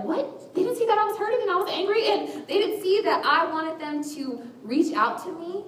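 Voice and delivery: high, incredulous complaint; mock indignation. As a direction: act offended that nobody noticed. Mock indignation at being misunderstood.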